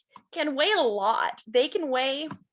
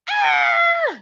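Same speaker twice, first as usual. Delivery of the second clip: high, loud shrieking scream; panther scream; 8 dB louder than usual. Acted. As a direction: let out a wild cat's shriek. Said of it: "It screamed".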